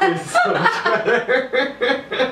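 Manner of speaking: said through laughter, high and slow; laughing. Real, not acted. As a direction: laugh the words out. Laughing at the reveal.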